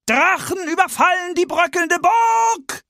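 town crier shout. Acted. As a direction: belt the headline like a town crier. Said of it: "rief der Ausrufer".

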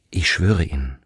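ordinary delivery

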